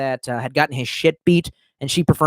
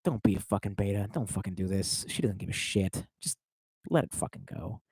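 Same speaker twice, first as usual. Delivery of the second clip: muttered self-talk; 8 semitones lower, 13 dB quieter than usual. Retold or imitated. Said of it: "was like".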